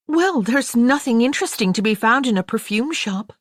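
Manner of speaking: put-on high, bored, teasing girl's voice; young American girl. A narrator's reading. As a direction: play the restless younger sister who whines. Daisy voiced again.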